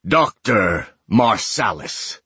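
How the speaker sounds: slow, drawn-out call down the corridor; shouting. A narrator's reading. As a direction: call the name out slowly. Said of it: "he shouts".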